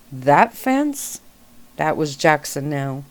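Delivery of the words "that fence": high incredulous disbelief; shocked, pitch jumping up; surprised. A narrator's reading. Shocked question about fence.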